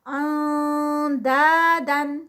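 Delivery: اندادن slow with a light nasal نون before د; light ikhfa recitation. Shown as a demonstration. Teacher recites slowly with light ikhfa. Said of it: "یہ بھی ٹو counts".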